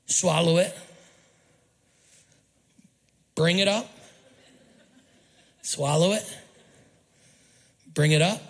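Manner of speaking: slow, separate phrases with long pauses; slow chewing demo. Shown as a demonstration. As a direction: act out chewing the cud, phrase by phrase. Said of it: "how cows would chew their cud".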